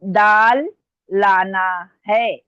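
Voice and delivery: slow, loud, long-held vowels; slow dictation. Real, not acted. Teacher giving students the next sentence, slow dictation. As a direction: dictate loudly, stretching every word. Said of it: "मैं आराम आराम से बोल रही हूँ".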